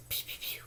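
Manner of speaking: pew pew whispered; whispered gun sounds. Shown as a demonstration.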